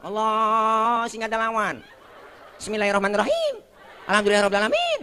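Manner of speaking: high, sustained mock prayer recitation; imam orang Ambon. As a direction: intone it high, rising at the line ends. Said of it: "teman kita dari Ambon". (bacaan salat bernada tinggi ala Ambon)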